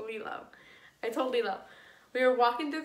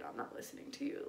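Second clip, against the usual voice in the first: whispered brush-off; 3 semitones higher, 12 dB quieter than usual. Retold or imitated. Brushing off crying brother.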